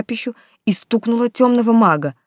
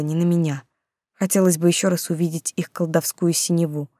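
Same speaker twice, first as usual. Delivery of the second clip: ordinary delivery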